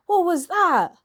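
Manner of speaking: what on earth reaction; incredulous. Real, not acted. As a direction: ask it baffled and appalled. Mock shock at the accent.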